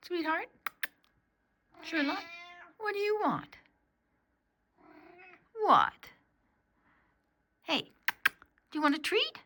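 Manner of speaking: high, sweet pet talk; talking to a dog. Real, not acted. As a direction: coax the dog with a treat. Speaking to Sherlock the dog.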